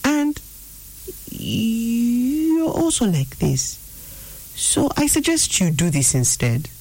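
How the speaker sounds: high, drawn-out voice of half-truths; insinuating inner voice. Acted. Deceiving voice leading up to its suggestion, insinuating. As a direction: stretch the words out high, insinuatingly. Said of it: "the voice can come and say".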